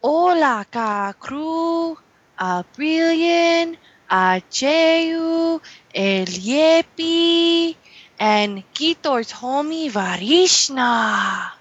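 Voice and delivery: put-on comic Mexican accent greeting; mock Mexican accent. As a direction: roll out the names in a thick mock Mexican accent. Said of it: "what's up with your accent".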